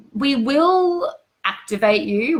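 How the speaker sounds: slow insistent vow; emphatic. Real, not acted. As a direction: stress on will, slow and rising. Emphatic promise.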